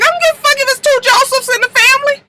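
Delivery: high-pitched, fast, indignant squawk; exasperated outburst. Retold or imitated. Vlogger retelling her reaction to the family's names, high-pitched exasperation. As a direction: rattle it out high, acting fed up.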